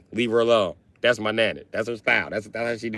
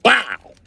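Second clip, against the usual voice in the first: high, loud mouthed gunshot; gunshot sound effect; 11 semitones higher, 4 dB louder than usual. Retold or imitated. Telling how nana shot the cop, mouthed gunshot. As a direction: bark out a bang.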